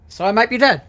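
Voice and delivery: excited. Acted. Character's alarmed realisation.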